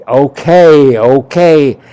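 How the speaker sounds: loud, drawn-out okay; emphatic retold agreement; retold giving in. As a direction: concede it loudly, twice over. Retold gentleman gives in to protest.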